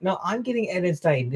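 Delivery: lightly mocking, insistent squabble; mock squabbling. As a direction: playfully mimic rivals fighting over a prize. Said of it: "everyone's just fighting over who's gonna get Titan".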